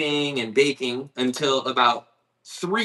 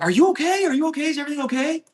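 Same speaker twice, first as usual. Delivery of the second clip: much higher, sweet concerned lady voice; kind lady; 13 semitones higher, much faster than usual. Retold or imitated. Mercedes driver checking on the van driver who rear-ended her, high sweet concern. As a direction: imitate the kind Mercedes lady fussing over him. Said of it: "she was like".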